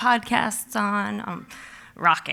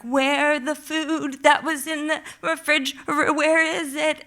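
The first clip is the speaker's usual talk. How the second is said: higher, louder frantic asking; frantic question; 7 semitones higher, 6 dB louder than usual. Retold or imitated. Asking the housekeeper where the food went, frantic. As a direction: press her for answers, panicking. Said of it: "And I'm like".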